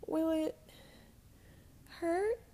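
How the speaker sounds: high, small scared question; scared child asking; frightened. Acted. Frightened girl asks.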